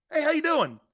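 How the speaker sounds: a quick sing-song stranger's greeting; friendly Southern greeting. Retold or imitated. Mocking the Southern hello Northerners never use, lightly cheery. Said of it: "nobody does that where we're from".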